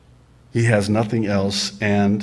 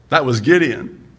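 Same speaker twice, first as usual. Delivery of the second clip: ordinary delivery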